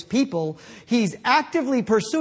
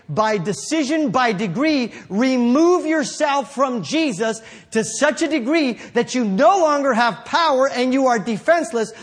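preaching at full force; 4 semitones higher than usual. Acted. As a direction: build the sermon to a roar.

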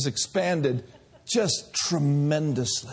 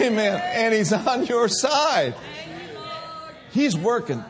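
full-force preaching; 3 semitones higher than usual. Acted. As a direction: whip the congregation up. Exhorting congregation, shouting praise.

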